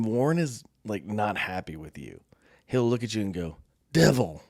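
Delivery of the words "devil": louder, clipped reproach; disappointed sergeant. Retold or imitated. Warren scolding the speaker for betraying him, mock reproach. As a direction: say it like a let-down friend. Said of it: "in a "Why would you do this to me?" tone".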